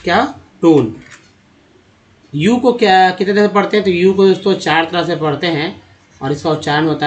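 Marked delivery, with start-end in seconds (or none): 0.6-1.0 टोन with a long "oh" vowel; long O sound. Shown as a demonstration. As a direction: say टोन clearly, vowel as in bone. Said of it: "ओ के रूप में होता है".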